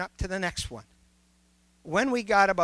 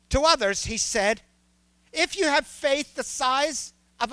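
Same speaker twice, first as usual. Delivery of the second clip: higher, louder preaching build; rising preacher; 5 semitones higher, 4 dB louder than usual. Acted. Preacher challenging the congregation with Jesus' words, fervent. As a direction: press the congregation with each question.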